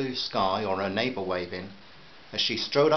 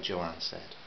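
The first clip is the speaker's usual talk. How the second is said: plain reading voice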